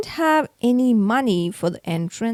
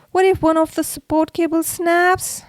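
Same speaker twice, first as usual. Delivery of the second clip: panicky; 6 semitones higher than usual. A narrator's reading. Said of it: "I thought, getting panically".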